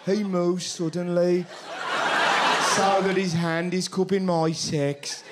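deadpan Brummie; comic Birmingham accent. Shown as a demonstration. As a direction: read it out in a broad Brummie accent.

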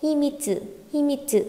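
"Himitsu" with a short stopped "mít" in the middle; sharp-tone "mít". Shown as a demonstration. Teacher shows students the joined sound, sharp middle syllable. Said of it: "Đánh dấu sắc vào chữ mít".

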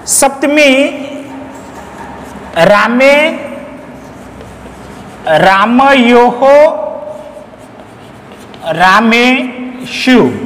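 each form drawn out, syllable by syllable; slow recitation. Shown as a demonstration. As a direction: recite Saptami, રામે, રામયોહો, રામેશુ very slowly with long pauses. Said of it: "Saptami અધિકરણ". (ધીમે ધીમે, છૂટું પાડીને પઠન)

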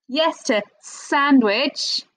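"Yes to sandwich" slow, "yes" rising high; slow emphatic yes. Shown as a demonstration. Teacher recapping answers, slow and emphatic. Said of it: "quick recap".

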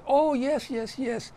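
high, eager hurried yeses; fawning German. Retold or imitated. German caught, fawning to soldiers.

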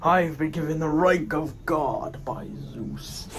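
a Spartan god mocking his foe; booming god voice. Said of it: "this booming voice".

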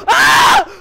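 sudden high, loud yelp; startled scream. Real, not acted. Player ducking a trap, startled scream. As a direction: scream as if hit.

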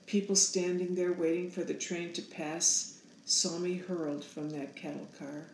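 ordinary delivery, nothing marked

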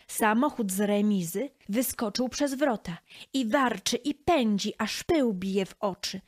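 usual speaking voice

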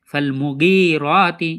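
slow মুগিরাতি, short মু and long held গি; correct elongation. Shown as a demonstration. Teacher answering how to read it properly, correct elongation. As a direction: keep মু brief, draw out গি and রা in মুগিরাতি. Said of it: "তো সঠিক পড়া কি হবে?".

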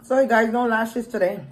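talking with mouth full; speaking between bites; chewing while talking. Real, not acted. Seen 2: eating meat with fingers, chewing as she speaks.

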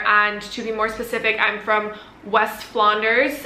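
ordinary talk, nothing marked

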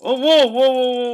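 high, loud startled whoas. Real, not acted. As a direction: react in sudden alarm. Opponent flees mid-fight.

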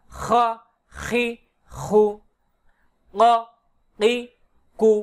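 isolated syllables like "গ গি গু", pause after each; pronunciation drill. Shown as a demonstration. Teacher runs through each letter once, pronunciation drill. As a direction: sound out "খ খি খু" as separate short syllables. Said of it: "আমি একবার উচ্চারণ করে যাই".

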